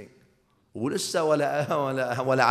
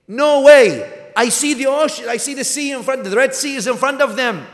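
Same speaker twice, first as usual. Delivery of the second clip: much louder and higher, ringing conviction; defiant insistence; 13 semitones higher, 12 dB louder than usual. Acted. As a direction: hurl it out over the crowd.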